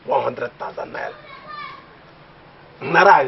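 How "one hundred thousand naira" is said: quiet musing. Real, not acted.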